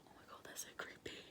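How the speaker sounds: fast, breathless whisper; terrified whisper. Real, not acted. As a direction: rush it out in a frightened hush. Spooked by sounds.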